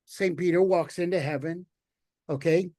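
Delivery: ordinary talk, nothing marked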